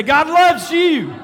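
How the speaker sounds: lifted, laughing, drawn-out declaration; with meaning. Shown as a demonstration. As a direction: say it like you truly mean it. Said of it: "Now say it again with meaning this time".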